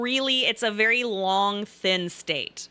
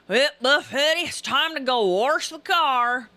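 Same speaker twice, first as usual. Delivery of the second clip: imitating dad; 4 semitones higher, 3 dB louder than usual. Imitating dad announcing it's time to wash the car, drawling country voice. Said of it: "my dad sounds like".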